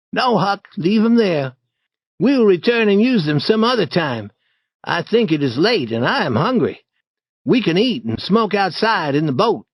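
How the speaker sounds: a hint of a boy taking charge; Tom.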